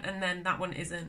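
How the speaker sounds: normal talking voice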